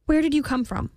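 high-pitched startled question; shocked. A narrator's reading. Girl startled by the stranger's arrival, shocked. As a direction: ask in startled surprise.

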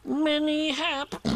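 high squeaky voice, cut short; Piglet. A narrator's reading. Piglet repeats his wish and is interrupted, high squeak. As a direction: start piping it up, then break off.

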